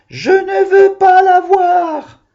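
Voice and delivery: high shrieking mock cry; mock scream. Child in the rhyme shrieking at the spider, mock scream. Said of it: "je crie".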